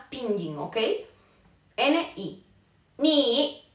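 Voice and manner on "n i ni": N, I spelled out, then ni joined up. Shown as a demonstration. Teacher spelling and saying ni. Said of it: "se escucha como una".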